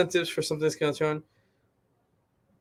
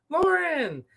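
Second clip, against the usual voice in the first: calling out; 12 semitones higher than usual. Real, not acted. Greeting a viewer by name.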